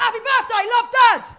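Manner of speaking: mock-aggressive high, loud yell; aggressive shout. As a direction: shout it as if all in capital letters. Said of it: "it looks aggressive".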